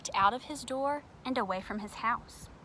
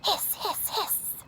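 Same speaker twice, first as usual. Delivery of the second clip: laughing hiss; 9 semitones higher, slower than usual. Acted. Triangle hissing, laughing.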